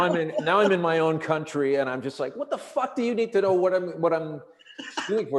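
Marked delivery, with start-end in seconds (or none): 2.3-5.4 retold exasperated demand, pitched up; pissed-off retort. Stranded traveler to a nosy airport cop, pissed-off retort. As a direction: demand an answer in mock outrage. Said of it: "now I'm getting pissed off".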